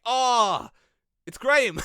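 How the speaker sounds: high, loud fake shock; mock alarm. Commentator faking shock at the boxer, hammed-up and loud. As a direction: ham up the surprise like bad acting. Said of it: "I was like".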